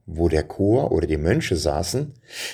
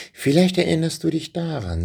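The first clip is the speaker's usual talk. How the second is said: put-on high, eager boy's voice; hopeful boy voice; 8 semitones higher than usual. Boy hopefully offers his mother's song, higher and eager.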